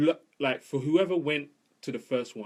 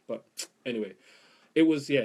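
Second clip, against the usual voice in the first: normal talking voice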